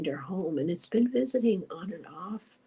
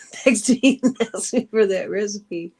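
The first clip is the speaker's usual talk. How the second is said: laughing; 3 semitones higher, 9 dB louder than usual. Real, not acted. Telling viewers how people beg for the recipe, laughing. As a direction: break into a real laugh while talking.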